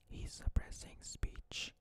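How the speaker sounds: slow, hushed whisper; slow conspiratorial whisper; dramatic whisper. Acted. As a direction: lean in and whisper it like a secret. Repeated whispered punchline.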